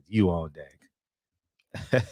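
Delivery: lightly mocked club announcer, low and soft; strip club DJ. Host calling the next dancer, low mock DJ voice. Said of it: "they always sound like that".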